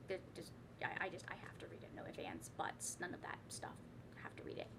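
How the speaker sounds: hushed whisper, almost to herself. Real, not acted. To viewers about the required September group book, whispered. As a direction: whisper it like a reluctant confession.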